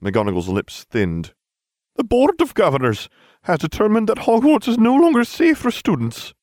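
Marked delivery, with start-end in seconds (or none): 2.0-6.3 much higher, louder prim teacher voice; strict old teacher, louder and much higher; stern headmistress. A narrator's reading. McGonagall voiced in story.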